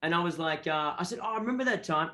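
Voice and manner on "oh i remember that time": pitched-up pretend old pal; stupid cool-guy talk. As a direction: bluff casually as if you were there. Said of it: "I cooled down the stupid talk".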